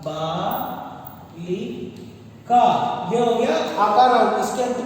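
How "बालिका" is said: बालिका stretched very slowly, syllable by syllable, softer; बालिका said very slowly with a long final आ; drawn-out demo. Shown as a demonstration. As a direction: pronounce बालिका slowly so children hear the final आ. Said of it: "अब जैसे एक शब्द आ गया बालिका".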